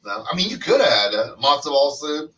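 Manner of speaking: normal talking voice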